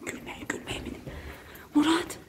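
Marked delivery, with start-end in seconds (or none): 0.1-1.0 hushed whisper to stop laughing; whispering while hiding. Real, not acted. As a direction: whisper to keep it secret. Hushing a giggler mid-prank.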